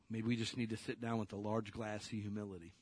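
ordinary talk

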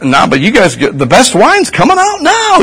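lightly voiced host, rising excitedly; a touch of party host bragging; governor's voice. Governor telling the bridegroom about the wine, excited.

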